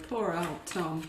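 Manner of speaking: ordinary delivery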